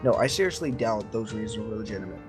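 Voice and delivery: plain reading voice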